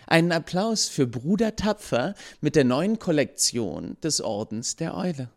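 high, eager marketing presenter; enthusiastic pitch. A narrator's reading. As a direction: sell it with corporate enthusiasm. Businesswoman pitching merchandise. (begeisterte Marketing-Präsentation, höher)

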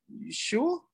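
high, soft double-check; imitating hesitant friend. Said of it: "And he paused. He was like".

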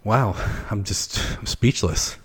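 normal talking voice

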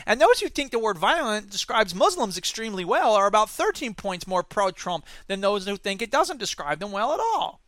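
ordinary talk

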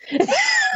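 high squealing laugh; high burst of laughter. Real, not acted. Laughing about Ghana's customer service, high squealing laugh.

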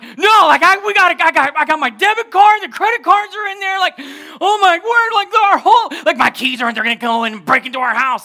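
high, loud, stumbling panic; hammed-up frantic wife spiraling; hysterical wife. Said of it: "You're hysterical right now".

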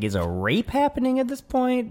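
very high disbelieving question; high incredulous voice. Retold or imitated. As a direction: squeak it in disbelief. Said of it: "I was like".

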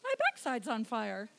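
understated, mildly curious mock reaction; mock nonchalance. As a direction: play it down as if barely bothered. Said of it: "Yeah, you don't go".

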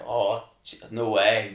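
loud, stunned no way; loud retold amazement; excited disbelief. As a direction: blurt it out, amazed at yourself. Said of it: "I was, like, screaming".

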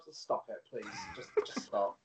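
mock weary plea.

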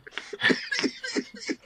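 high giggle. Acted. Giggle inside the skit.

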